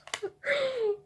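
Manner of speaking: shrill laugh through the spice heat; high pained laugh. Real, not acted. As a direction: laugh it off with a burning mouth.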